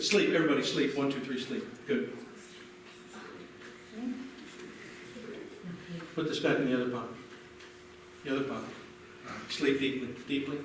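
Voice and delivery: low hushed induction; hypnotist sleep command. Acted. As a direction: sink them into sleep softly.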